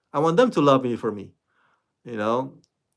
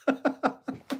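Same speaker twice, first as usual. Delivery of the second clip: high laugh; 11 semitones higher, 9 dB quieter than usual. Real, not acted. Laughs at the quote.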